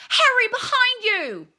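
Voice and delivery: high, loud warning shout; anguished yell. Acted. Captain warning Harry from the goalposts, anguished yell. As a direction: scream the warning across the field. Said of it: "Wood's anguished yell".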